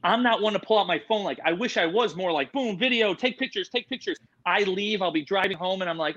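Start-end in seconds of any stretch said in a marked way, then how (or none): 2.5-4.2 much higher, rapid-fire mock excitement; eager mock urgency. Visitor wishing he took more photos, eager mock urgency. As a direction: fire it off like an imagined snap-happy visitor. Imagines himself eagerly snapping pictures.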